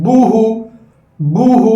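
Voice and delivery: बूहू said with pursed, forward lips; rounded lips. Shown as a demonstration. Teacher drilling pupils on the pesh u sound. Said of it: "होठों को आगे बढ़ाना".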